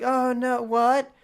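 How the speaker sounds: high, teasing, clingy voice; smug whiny brother. Retold or imitated. Brother refusing to leave the bed, high teasing whine. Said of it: "And he's like".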